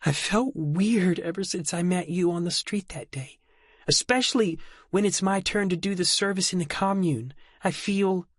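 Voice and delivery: high voice cracking with tears; voice breaking. Acted. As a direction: let the voice break at the end. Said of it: "Her voice broke".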